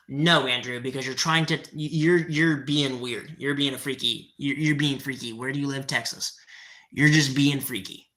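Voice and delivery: low, deadpan, a bit put off; weirded out. Real, not acted. Streamer replying to a creepy chatter, deadpan and low. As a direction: push away a creepy comment flatly.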